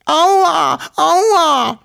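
very high, loud cries of pain; pained yelps. A narrator's reading. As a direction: squeal like a small creature being spanked. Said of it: "schrie er". (hohe, laute Schmerzensschreie)

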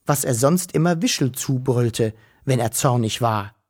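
ordinary delivery

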